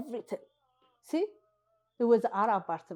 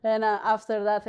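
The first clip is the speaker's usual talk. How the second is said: usual speaking voice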